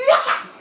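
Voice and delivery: very high, short squeal; imitated pained yelp. Shot man reacts to tight zip ties, pained yelp. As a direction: squeal as if it hurts. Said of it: "that stuff was hurting me".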